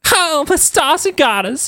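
put-on high, slow Southern accent; Paige. Country girl Paige exclaiming, high Southern drawl. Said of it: "I'll read for Paige 'cause she is Southern".

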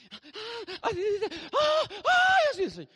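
high, loud mock wailing; hammed-up crying prophetess; imitated tearful prophesying. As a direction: wail like weeping too much to speak. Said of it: "como lloran mucho para hablar".

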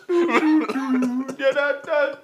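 very slow, drawn-out high laughing taunt; mock taunt. Repeating the taunt, dragged out slow through laughter. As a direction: stretch every word out mockingly.

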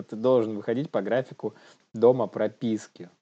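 ordinary talk, nothing marked

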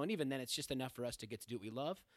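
normal talking voice

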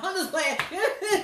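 slow, laughing and loud; giggling I'm just playing. Real, not acted. Laughs after the dig.

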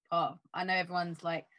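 usual speaking voice

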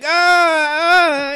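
high yelp of pain; imitated pain cry. Retelling a wrestling friend yelping in pain. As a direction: mimic a friend crying out in a hold. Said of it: "He'd be like".

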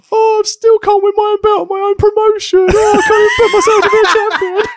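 high, loud, far-off whining wail; whiny heel promo. Retold or imitated. Heel manager whining at the crowd, high mock wail. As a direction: wail it like a sore loser heel. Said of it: "the exact words I was using ... going".

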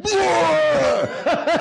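cartoon vomit sound out the car window; mock vomit. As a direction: spit out a hammed-up puke noise. Imitating friend vomiting out window.

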